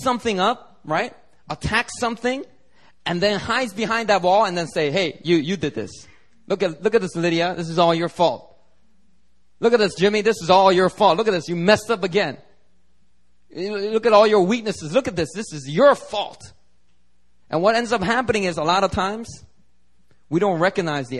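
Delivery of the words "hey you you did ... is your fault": pointed, emphatic accusations piling up; accusing blame. Acted. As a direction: lay the blame squarely on them, pressing harder. Acted Satan blaming the believer.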